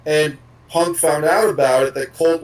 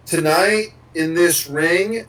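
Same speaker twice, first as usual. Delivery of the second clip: slow, deliberate mimicry of a wrestling promo; same promo cadence; slower than usual. Host mimics what every wrestler says, slow repetitive cadence. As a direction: draw out each phrase like a rote catchphrase. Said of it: "with the very same cadence".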